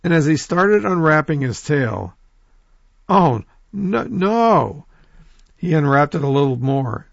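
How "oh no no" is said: high, dismayed "oh no" rising in alarm; shocked cry. Acted. Possum sees his naked tail, shocked high cry. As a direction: cry out as if the tail hair is gone.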